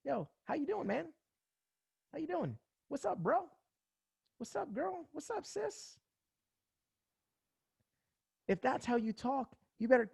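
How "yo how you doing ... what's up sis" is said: high, loose and casual greeting to friends; way up high, easygoing and friendly. Retold or imitated. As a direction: toss it off like greeting your buddies. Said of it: "or do you go".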